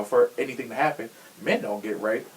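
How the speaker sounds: usual speaking voice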